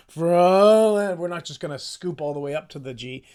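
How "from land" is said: "from land" belted much louder, straight to the note; precise loud hit. Shown as a demonstration. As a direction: land "from" squarely, full voice.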